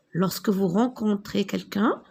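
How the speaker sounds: normal talking voice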